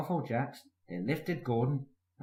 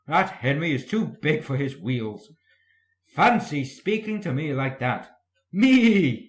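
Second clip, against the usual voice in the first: louder huffy engine voice; indignant; 3 semitones higher, 8 dB louder than usual. A narrator's reading. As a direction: puff up with offended pride. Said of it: "he went on, letting off steam".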